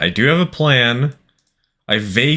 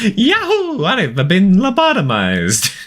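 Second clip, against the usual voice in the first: very high, drawn-out cartoon plumber voice; Mario; 11 semitones higher, slower than usual. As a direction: whoop it out in an exaggerated Italian accent. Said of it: "I can do a killer Mario impression".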